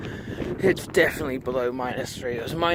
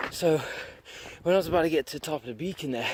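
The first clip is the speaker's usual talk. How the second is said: normal talking voice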